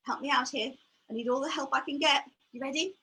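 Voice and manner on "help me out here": ordinary talk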